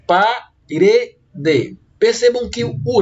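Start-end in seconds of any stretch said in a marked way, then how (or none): 0.1-1.8 "Parede" as pa, re, de with pauses; measured syllable-by-syllable "Parede"; split into syllables. Shown as a demonstration. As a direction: break "Parede" into three separate, spelled-out syllables. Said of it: "dividimos a palavra parede em três sílabas".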